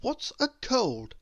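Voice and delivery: lightly voiced puzzled Mister Sneeze; puzzled question. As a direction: wonder aloud, a little lost. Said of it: "asked Mister Sneeze".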